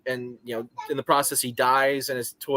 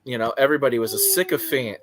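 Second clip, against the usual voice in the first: ordinary talk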